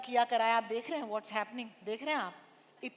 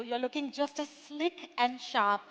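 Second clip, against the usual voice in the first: ordinary talk, nothing marked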